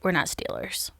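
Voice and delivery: hushed, unvoiced-sounding aside; mock whisper. Hushed mock aside after joke.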